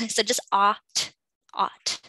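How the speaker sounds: "ought" with an open ah vowel; ah sound in ought. Shown as a demonstration. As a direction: stretch the ah in "ought". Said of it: "this is like an ah sound".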